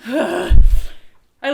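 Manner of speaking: weary exasperation; exasperated. A narrator's reading. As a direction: sigh it out, fed up.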